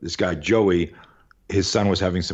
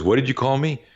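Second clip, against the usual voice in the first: higher-pitched, mock confrontational demand; confrontational challenge; 7 semitones higher than usual. Bully challenging the boy he tripped, confrontational. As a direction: act out an affronted challenge, lightly heated.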